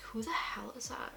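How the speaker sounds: hushed whisper of confusion. Retold or imitated. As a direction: whisper it to yourself, baffled. Retelling confused first sight.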